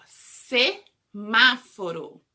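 "Semáforo" said very slowly, every syllable long; slow modelled word. Shown as a demonstration. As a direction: stretch "Semáforo" out for kids to copy. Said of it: "nós temos o semáforo".

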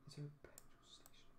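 soft whispered question. Real, not acted. Driver quietly wondering aloud about a petrol station, whispering. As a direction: breathe the question without voice.

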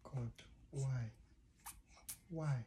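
whisper, worn-out; whispered despair. Real, not acted. Exhausted dad whispering to himself after bedtime battle. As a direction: breathe the question out in defeat.

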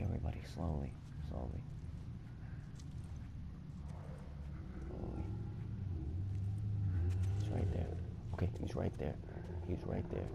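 tense whispered self-talk; hushed whisper. Real, not acted. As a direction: sneak past it whispering. Sneaking past the monster.

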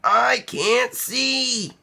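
high, slow smothered complaint; muffled Mouse. Acted. Mouse smothered by Rabbit's hug, high and slow. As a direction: sound buried in a hug.

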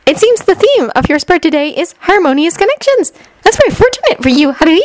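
put-on very high-pitched, girlish reading; eager young fortune-teller. Shinobu reads Haruhi her tarot spread, high and eager. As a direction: present the cards in a high, sweet little voice.

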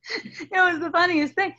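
a teary laugh while telling it; real giggle with tears in the voice; laughing through tears. Real, not acted. Laughing after tearing up.

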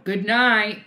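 loud, high goodnight call; yelling across. Real, not acted. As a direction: holler it to the next room. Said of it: "I was just gonna yell it across".

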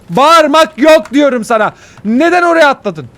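high, loud angry yell; screaming at your own mistake; furious scolding. Real, not acted. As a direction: shout it in exasperation. Scolds self for shouting and jumping.

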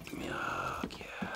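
menacing whisper. Acted. False comfort before killing.

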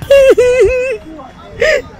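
high wailing fake cry. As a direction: put on a sudden wail. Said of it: "Yeah, cry".

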